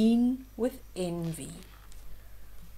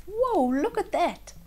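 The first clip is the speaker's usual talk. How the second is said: high, amazed exclamation; surprised whoa; 8 semitones higher than usual. Real, not acted. Showing children the book picture, amazed whoa. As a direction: gasp at the picture.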